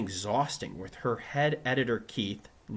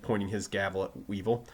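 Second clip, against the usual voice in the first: ordinary delivery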